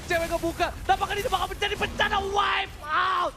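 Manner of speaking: fighting game announcer. Real, not acted. Fast esports play-by-play climax.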